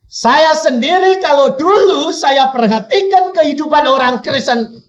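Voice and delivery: high, loud preaching voice; forceful testimony. Real, not acted.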